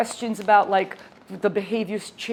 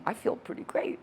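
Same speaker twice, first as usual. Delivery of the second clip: quiet satisfied aside; 3 semitones higher, 7 dB quieter than usual. Retold or imitated. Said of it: "my client's going".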